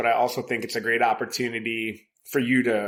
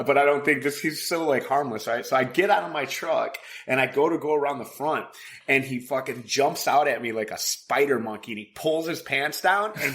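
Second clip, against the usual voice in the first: ordinary talk, nothing marked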